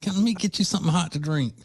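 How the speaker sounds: high cooing, fussing over someone; doting comfort voice. Retold or imitated. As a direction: coo over a sick friend. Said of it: "you wanna go".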